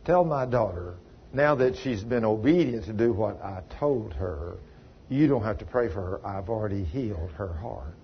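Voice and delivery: lightly voiced Lord, low and soft; voice of God. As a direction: speak God's words low and hushed. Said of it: "He said".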